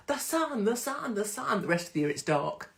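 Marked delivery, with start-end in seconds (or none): 0.1-1.6 mock joy. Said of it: "So they're like".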